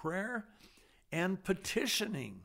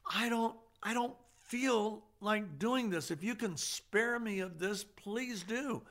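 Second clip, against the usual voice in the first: pleading with the Father; 8 semitones higher than usual. Retold or imitated. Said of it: "telling the Father".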